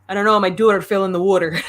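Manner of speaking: imitated Pennsylvania accent, rounded vowels. Fans mimicking the show's dialogue at home, playful Pennsylvania accent. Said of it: "I don't know that accent very well".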